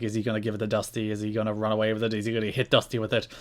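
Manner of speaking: hushed commentary. Retold or imitated. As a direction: tease the crowd in a low rush. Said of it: "he's like".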